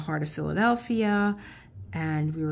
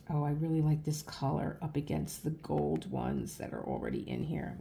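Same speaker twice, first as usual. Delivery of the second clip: ordinary talk, nothing marked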